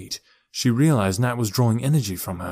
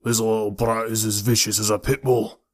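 throaty thug with a Scottish lilt; deep, throaty Scottish. A narrator's reading. Pinky explains his tattoo, throaty Scottish voice. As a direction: speak from the throat with a hint of Scottish. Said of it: "His voice was deep and throaty".

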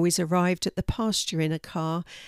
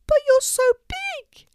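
very high, loud little squeak; squeaking mouse; 8 dB louder than usual. A narrator's reading. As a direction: protest as a tiny scared mouse. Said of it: "squeaked the mouse".

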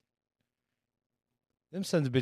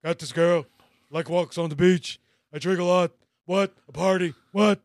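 loud, higher wrestler bark of What; Stone Cold voice; 5 semitones higher, 7 dB louder than usual. Retold or imitated. Reading a Tinder profile as a wrestler, loud and barking. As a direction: shout it like a pro wrestler promo. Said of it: "read it in Stone Cold Steve Austin's voice".

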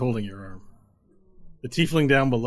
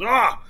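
much higher, loud yelp of falling; pained yelp; 12 semitones higher, 8 dB louder than usual. Acted. Customer crying out as his chair breaks. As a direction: cry out as you hit the floor. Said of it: "falls to the ground and goes".

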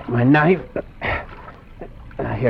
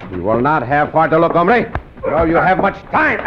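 triumphant challenge; 8 semitones higher, 4 dB louder than usual. Acted. Cisco springs ambush on bandit.

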